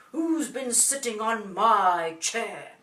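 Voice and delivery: big, loud, slow father bear; deep Daddy voice. A narrator's reading. Daddy bear finds his chair disturbed, deep booming voice. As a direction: boom it slowly in a deep Daddy voice. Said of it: "says in his deep voice again".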